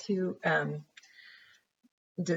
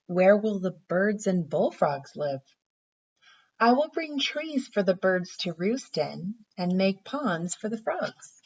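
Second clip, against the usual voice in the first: gentle storybook read-aloud; reading to children; 3 semitones higher than usual. Acted. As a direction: read it like a picture book for little kids. Teacher reads picture book aloud.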